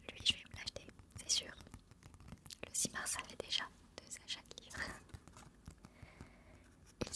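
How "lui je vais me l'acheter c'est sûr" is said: ordinary talk, nothing marked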